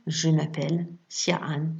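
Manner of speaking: normal talking voice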